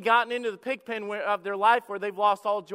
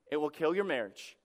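ordinary talk, nothing marked

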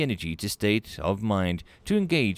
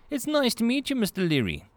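bright high welcoming voice, clearly higher; a cheerful human clerk, pitched up; friendly receptionist Melanie; 13 semitones higher than usual. Acted. Clerk greets a nervous alien visitor, high and friendly.

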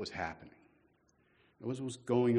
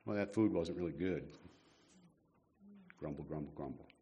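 muttered mock grumble, fading quieter; mock grumbling; 7 semitones lower, 8 dB quieter than usual. Preacher mimics complaining Israelites, mock muttering. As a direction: grumble it low under your breath, sulky. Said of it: "It's like, don't grumble".

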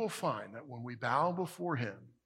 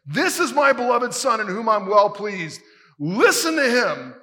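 booming, much louder and higher, like a voice from heaven; 8 semitones higher, 12 dB louder than usual. Retold or imitated. Preacher voices the heavenly Father booming to the disciples. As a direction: boom it out as God speaking from the clouds. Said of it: "this booming voice from heaven".

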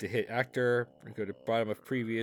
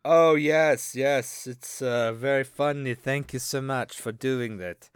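louder, slower put-on voice; new character; 7 dB louder than usual. Co-host showing off his new character, louder and slow. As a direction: present a pompous new character. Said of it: "this is a new character I work on".

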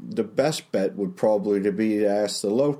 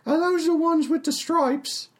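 higher chatty tavern barmaid. Acted. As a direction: play the friendly, simple barmaid. GM voices Tallulah the barmaid.

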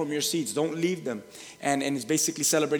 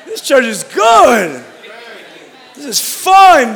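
shouted prompt; 9 semitones higher, 13 dB louder than usual. Pretend. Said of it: "Look at that person next to you and say".

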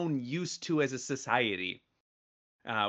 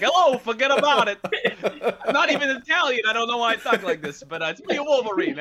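much higher, louder hammed-up New York Italian accent through laughter; mock Italian tough guy; 10 semitones higher, 7 dB louder than usual. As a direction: play Wolverine as a laughing, over-the-top Italian wise guy. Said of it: "Is that how his Wolverine is gonna sound".

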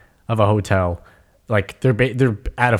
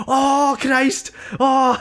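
very high mock-Welsh exclamation; Darren Till impression; much slower than usual. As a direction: exclaim in a hammed-up, pitched-up Welsh accent. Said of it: "I can't do a Welsh accent".

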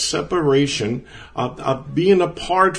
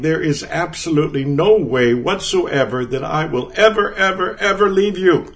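louder and higher ever, ever, ever; preacher hammering the word ever; emphatic promise; 5 semitones higher, 4 dB louder than usual. Retold or imitated. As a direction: drive every ever home, rising in intensity. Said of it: "It's like saying".

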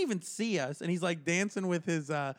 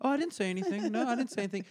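meek; 5 semitones higher, faster than usual. Retold or imitated. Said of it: "soft if I go".

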